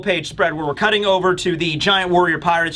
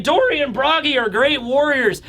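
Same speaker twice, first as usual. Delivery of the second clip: usual speaking voice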